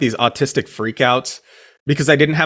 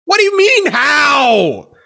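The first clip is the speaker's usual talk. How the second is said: incredulous; 13 semitones higher, 5 dB louder, much slower than usual. Real, not acted. Disbelief at friend's question.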